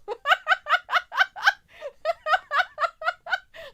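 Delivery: long, high, loud cackle; real high-pitched laughing fit; helpless laughter. Real, not acted. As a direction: crack up in a long peal of laughs. Cracks up at the ancestors joke.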